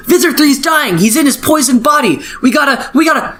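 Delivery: breathless high mock panic. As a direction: squeal it out in hammed-up alarm. Group panics in retold fight.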